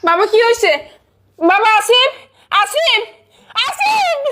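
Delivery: rising, desperate calls; panicked. Acted. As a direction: call her dying son in terror. Mother panicking over son. (আতঙ্কে চিৎকার করে ডাকা)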